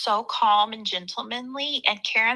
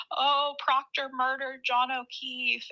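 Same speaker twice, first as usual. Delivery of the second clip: higher, slow mock lament; imitated fake crying; 5 semitones higher, 4 dB quieter, slower than usual. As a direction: lightly mimic a put-on tearful wail. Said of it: "crying or pretending to cry".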